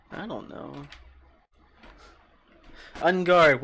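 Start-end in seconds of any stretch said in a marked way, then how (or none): 3.0-3.6 loud hammed-up "On guard"; playful loud challenge; mock battle cry. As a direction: yell it like a mock fencer drawing a baguette. Mock duel with baguette.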